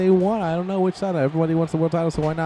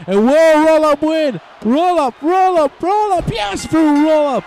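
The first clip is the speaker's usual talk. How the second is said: much higher and louder, calling it out; excited yelling; 13 semitones higher, 9 dB louder, slower than usual. Real, not acted. Commentator calling the surprise roll-up win, yelling with excitement. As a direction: explode as the pin lands.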